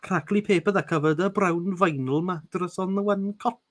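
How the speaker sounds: relishing a Welsh lilt; Welsh accent. Acted. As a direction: roll the Welsh words lovingly. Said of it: "Good Welsh word".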